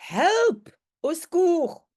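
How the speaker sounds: high, loud mock call for help; acted cry for help. Teacher voicing the terrified professor: high, loud cry for help. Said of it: "il crie encore".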